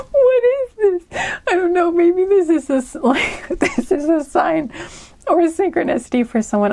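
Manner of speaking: high giggly voice gradually settling; laughing fit easing. Real, not acted. Reader wondering if it is a sign, giggling then calming. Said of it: "I don't know why I'm laughing".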